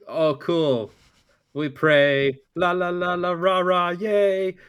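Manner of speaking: high, loud sing-song mockery; mocking. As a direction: parody a cheesy chant.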